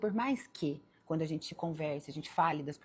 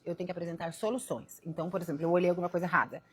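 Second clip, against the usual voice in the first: ordinary delivery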